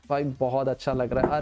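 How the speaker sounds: ordinary delivery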